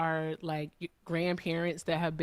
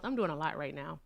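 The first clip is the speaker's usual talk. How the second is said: usual speaking voice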